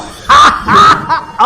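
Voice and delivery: a loud, high shouted laugh; loud laugh burst. Real, not acted. Streamer laughing at his own reveal: loud high laugh. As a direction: crack up at full volume.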